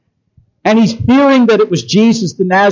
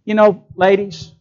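ordinary delivery

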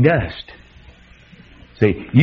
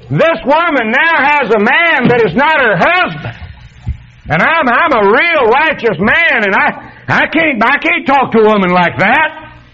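high, slow, hammed-up prig voice; mock self-righteous man; 11 semitones higher, slower than usual. Preacher mocking the righteous man to the congregation, hammed-up high prig voice. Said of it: "I might have said".

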